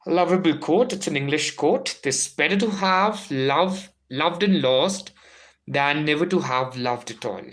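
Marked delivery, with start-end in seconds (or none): 2.0-7.5 lingering dramatic recital; emphatic recitation. Shown as a demonstration. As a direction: savour each word of the line. Quote reread slowly, emphatically.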